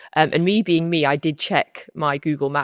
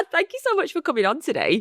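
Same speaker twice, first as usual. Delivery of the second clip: high-pitched, polite presenter thanks; posh presenter voice; 10 semitones higher than usual. Real, not acted. Host thanks guest for coming on, posh presenter voice. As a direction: put on your posh hosting voice. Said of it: "you've put on your posh voice".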